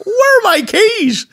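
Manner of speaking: put-on very high, slow wobbly old-lady voice; dotty old granny. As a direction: whine it like a lost granny.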